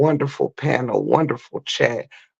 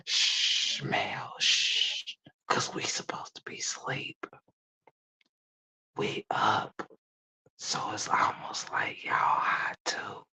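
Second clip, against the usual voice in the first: hushed whisper; 5 semitones lower, 12 dB quieter, slower than usual. Acted. Pretending to be asleep at night.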